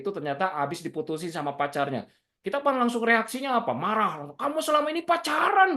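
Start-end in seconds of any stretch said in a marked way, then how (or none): 4.4-5.7 high, fiery accusation; angry mother. Retold or imitated. Mother confronting her daughter about dating, high fiery outburst. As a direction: blurt it out in a sudden rage. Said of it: "langsung marah berapi-api".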